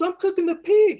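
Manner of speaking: high-pitched mock country wife; defensive wife. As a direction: answer back in playful protest. Wife answers husband's challenge.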